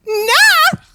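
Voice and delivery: sudden loud burst of refusal; loud nah. Real, not acted. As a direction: blurt out a loud no.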